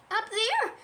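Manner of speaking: high little squeaky voice; timid high-pitched creature; frightened Piglet. Acted. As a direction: answer in a small, high squeak. Frightened Piglet answers Christopher Robin.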